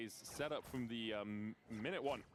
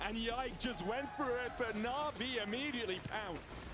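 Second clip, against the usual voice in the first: excited call; 9 semitones higher than usual. Real, not acted. Caster calls a sudden fight.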